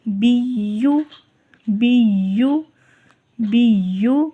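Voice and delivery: بیو with the ya pressed and doubled; correct biyyu. Shown as a demonstration. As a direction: repeat بیو with a firm ya. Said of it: "اس کو بیو نہیں پڑھنا". (بیو تشدید کے ساتھ)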